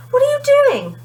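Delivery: put-on high, loud boy calling out; kid's voice. A narrator's reading. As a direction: chase after her, asking. Omar's high kid voice.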